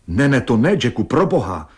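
understated frantic outcry; agitated shriek. A narrator's reading. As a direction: plead in panic, without really screaming. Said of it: "vyjekla v neovladatelném vzrušení".

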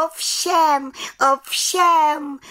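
high, proud eager calls; calling out eagerly. A narrator's reading. Little witch proudly calling out to the council, high. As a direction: call it out twice, high and proud. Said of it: "volala malá čarodějnice".

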